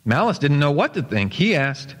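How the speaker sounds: plain reading voice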